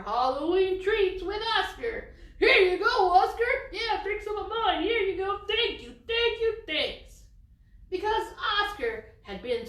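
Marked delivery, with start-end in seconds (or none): 2.4-7.2 chattering dogs. Acted. Dogs share treats with Oscar.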